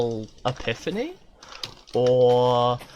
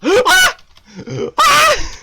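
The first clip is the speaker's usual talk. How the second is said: very high loud shriek; real frightened scream; gasp and scream; 11 dB louder than usual. Real, not acted. Jump scare.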